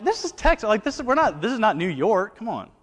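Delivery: high, loud, incredulous protest; exasperated retort. Retold or imitated. To Alabama doubters, a high loud exasperated protest. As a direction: insist it's obviously not New York. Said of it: "I'm like".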